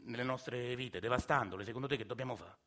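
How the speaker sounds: ordinary talk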